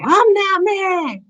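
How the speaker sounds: drawn-out, very high mock yell; yelled denial. Speaker re-enacting insisting she's not mad, yelling it. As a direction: stretch the denial into a high wail. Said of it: "But I'm yelling, saying I'm not mad".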